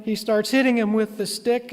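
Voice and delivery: ordinary delivery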